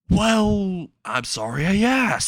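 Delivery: high, drawn-out villain wail; mock pained yell. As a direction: yell it as if on fire. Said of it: "while burning and yelling".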